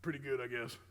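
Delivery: withholding coach shrugging it off; lukewarm praise. Retold or imitated. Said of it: "All right".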